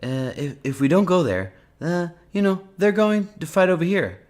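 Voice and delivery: high, put-on presidential cadence; Trump accent. Answering a journalist's question, in a high Trump accent. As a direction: imitate the president, voice pitched well up. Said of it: "in a Trump accent?".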